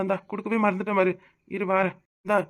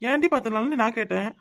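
usual speaking voice

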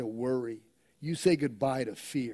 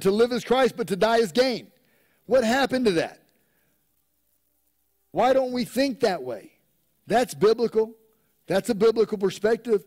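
louder, higher preaching, pressing each point; full-force preaching; 4 semitones higher, 6 dB louder than usual. Acted. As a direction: preach it out with rising fervor.

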